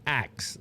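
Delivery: "Ax" rhyming with "tax", meaning ask. Shown as a demonstration. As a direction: say "Ax" for ask, with the k before the s. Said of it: "they say I mispronounce".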